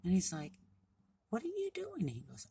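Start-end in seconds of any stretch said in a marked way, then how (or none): 1.3-2.1 high-pitched frog asking a question; curious little frog. Acted. Frog greets beaver in story.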